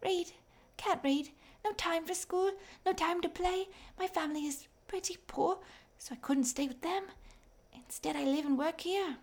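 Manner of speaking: put-on high, thin, raspy little girl voice. A narrator's reading. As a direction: call out like a tired, skinny child selling coal. Fanny the mine girl speaks.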